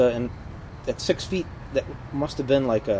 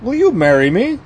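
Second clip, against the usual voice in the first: prince voice; 10 semitones higher, 11 dB louder than usual. Acted. Acted prince line in joke.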